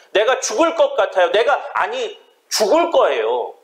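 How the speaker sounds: high, pressing preacher's plea; impassioned preaching. Acted. Preacher voices a desperate plea to God, impassioned. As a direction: cry out the psalmist's prayer for the congregation.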